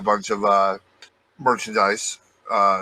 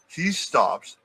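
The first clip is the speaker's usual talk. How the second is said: ordinary talk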